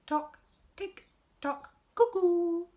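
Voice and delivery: high, loud ticking and a cuckoo call; playful clock effect; clock noises. Acted.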